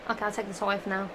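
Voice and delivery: usual speaking voice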